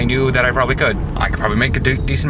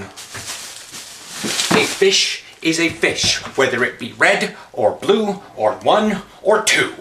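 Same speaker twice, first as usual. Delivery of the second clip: rhyming recitation; 2 semitones higher, much slower than usual. Acted. Quoting Dr. Seuss-like rhyme.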